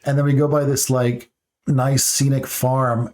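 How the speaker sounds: normal talking voice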